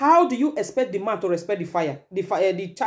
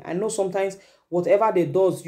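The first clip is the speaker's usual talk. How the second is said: ordinary talk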